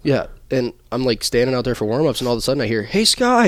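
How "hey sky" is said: a high, loud call across the ice. Retold or imitated. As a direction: shout his name to catch his eye. Teammate calling out across rink.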